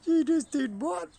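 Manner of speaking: high, loud, slow outraged bird; mock-outraged boyfriend rooster; jealous rooster voice. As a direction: voice the offended rooster. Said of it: "He's like".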